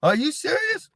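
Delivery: mild mock yell, pitched high; retold yell. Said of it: "He's yelling. He's like".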